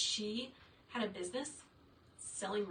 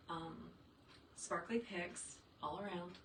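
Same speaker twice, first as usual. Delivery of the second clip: ordinary delivery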